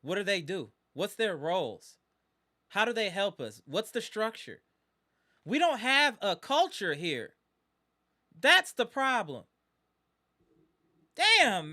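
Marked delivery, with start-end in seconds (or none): 5.5-11.7 frustrated loud outburst. Real, not acted. Frustrated fan venting at his team.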